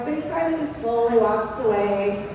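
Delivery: ordinary talk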